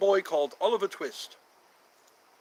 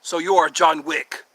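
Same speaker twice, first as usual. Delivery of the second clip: louder, big welcome to a viewer; excited greeting; 7 dB louder than usual. Real, not acted. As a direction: greet John Wick with a loud flourish.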